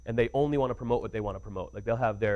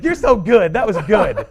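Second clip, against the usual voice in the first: laughing praise; 8 semitones higher, 11 dB louder, slower than usual. Retold or imitated. [name] praising him, quoted.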